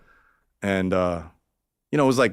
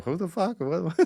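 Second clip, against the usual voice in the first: baffled who; 10 semitones higher, 4 dB quieter than usual. Retold or imitated. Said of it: "always the guy that's like".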